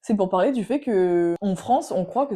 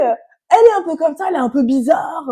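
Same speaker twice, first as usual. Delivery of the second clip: loud, high-pitched caricatured remark; imitated German friend; 8 semitones higher, 10 dB louder than usual. German girl talks about her sister, imitated loud high voice.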